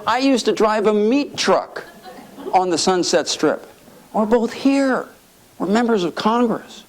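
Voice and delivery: high-pitched incredulous marvelling; Sonny in awe. Retold or imitated. Congressman marvelling to colleague at their rise, awed. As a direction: imitate someone amazed at his own luck. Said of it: "he was just in awe".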